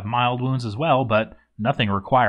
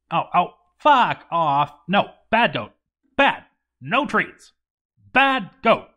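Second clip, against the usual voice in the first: scolding a goat; 6 semitones higher than usual. Acted. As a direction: shoo the goat off, high and sharp.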